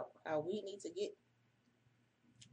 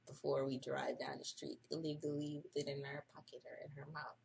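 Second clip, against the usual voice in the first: ordinary talk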